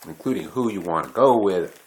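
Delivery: usual speaking voice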